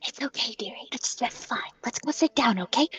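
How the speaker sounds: soothing whisper. Acted. Rita soothes the drunk.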